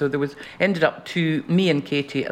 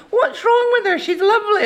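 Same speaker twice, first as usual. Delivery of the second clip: put-on high little-girl voice; sweet pleading child. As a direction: squeak it like a young girl defending her. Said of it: "And I was saying".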